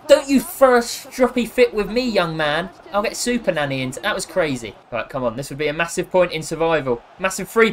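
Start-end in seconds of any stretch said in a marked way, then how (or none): 0.1-3.8 loud, raised telling-off voice; mock scolding. Streamer telling off game player like a naughty child, mock stern. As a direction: scold like a strict nanny.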